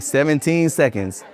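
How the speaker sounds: normal talking voice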